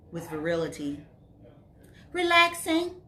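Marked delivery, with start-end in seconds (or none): 2.1-3.0 high, loud, singsong reply; pitched well up and sustained; lilting answer. A narrator's reading. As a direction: answer the caller in a raised lilt. Woman answers boyfriend's phone call.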